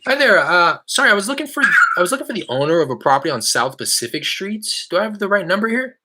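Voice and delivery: high upbeat cold-call voice; eager phone greeting. Real, not acted. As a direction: sound friendly and light to a stranger. Live cold call pitch.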